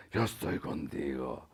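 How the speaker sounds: very low, hushed near-whisper; little voice. Acted. God's voice as we hear it, hushed little voice. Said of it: "una vocecita venía y le decía".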